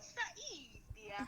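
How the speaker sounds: mock Italian plumber chirp; a hint of Luigi calling his brother; Luigi-style voice. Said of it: "I'd be like".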